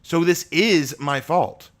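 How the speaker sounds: slow, loud, stress on is; slow insistence. Acted. Abraham declaring his guilt to Asher, slow and emphatic. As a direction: lean hard on it, slowly.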